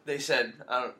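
normal talking voice

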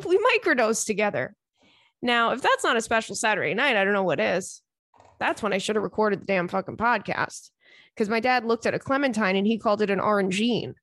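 normal talking voice

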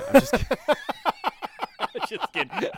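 high, loud laughing voice. Real, not acted. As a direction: laugh through it. Laughing off a fake confession.

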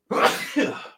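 one sudden sharp real sneeze; sneezing. Real, not acted. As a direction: let out a full sneeze. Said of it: "sorry, I'm gonna sneeze".

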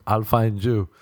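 ordinary delivery

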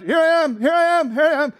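high, loud repeated reply; eager servant. Acted. Little attendant keeps answering his master, eager and loud. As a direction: pipe up over-keenly every time.

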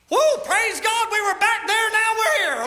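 high, loud mock celebration shouting woo; hammed-up victory cry, stretched out; mock jubilant praise. Preacher mocking a church that expects constant triumph, high and loud.

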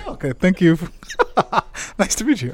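laughing greeting; happy laugh through hello; joyful reunion. Real, not acted.

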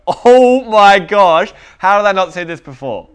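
loud, slow, high gasp of discovery; amazed revelation. Retold or imitated. Reader suddenly grasping the passage, shouting in amazement. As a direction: blurt it out like it just clicked.

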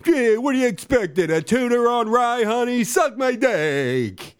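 high, sing-song comic voice; hammed-up character voice. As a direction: ham up a high goofy voice. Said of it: "now that's how you do an impression".

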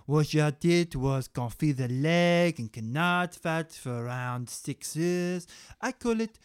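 hammed-up fake French chef; broken French accent. Chef Gary pitches his stew in mock French. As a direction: ham it up as a fake French cook. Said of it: "impersonation of a cannibalistic chef with a broken French accent".

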